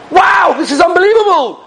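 high-pitched shout of wonder; amazed exclamation. Retold or imitated. Father watching fire come down on scholars, retold amazement. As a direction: cry out in awe, imitating an astonished onlooker.

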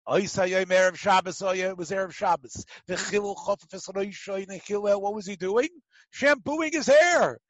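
ordinary delivery

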